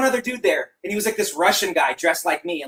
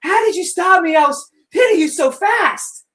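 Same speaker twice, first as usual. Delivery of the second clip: higher, louder mock outrage; retold angry protest, pitched up; angry girlfriend imitation; 9 semitones higher, 5 dB louder than usual. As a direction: imitate an indignant girlfriend, stretching the last words. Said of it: "and she's all angry".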